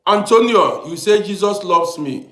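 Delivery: ordinary talk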